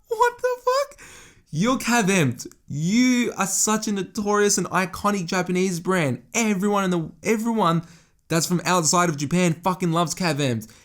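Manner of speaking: high-pitched incredulous rant; outraged disbelief. Retold or imitated. Customer told to change on the shop floor, mock outrage. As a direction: play it as a retold outburst of disbelief.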